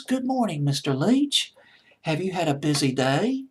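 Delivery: high-pitched imitation of a British woman; British accent. Woman greeting Mister Leitch as she comes in, British accent. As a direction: mimic a lovely British lady's greeting. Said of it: "absolutely lovely British accent".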